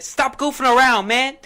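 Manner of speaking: high, loud caricature of an opponent; exaggerated high scolding holler; mimicking Bradley's voice. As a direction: yell it in a high-pitched mocking imitation. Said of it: "that does sound like him".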